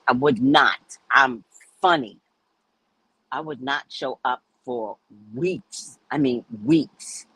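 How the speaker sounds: ordinary talk, nothing marked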